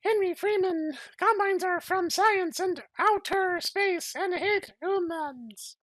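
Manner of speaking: high falsetto mother's voice; mock mother in falsetto; lady's voice. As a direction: play the mom in a high put-on voice. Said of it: "I have to do a lady's voice".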